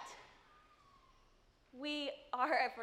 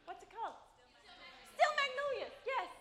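ordinary talk, nothing marked

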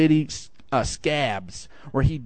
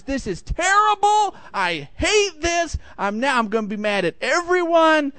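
miserable complaint; 11 semitones higher than usual. Retold or imitated. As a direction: whine like a kid hating every moment. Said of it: "Everything was just sheer misery".

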